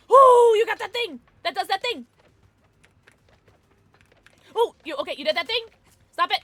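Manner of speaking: loud, high, thrilled reaction; excited amazement. Real, not acted. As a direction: gush over a teammate's clutch play. Reacting to a teammate's play.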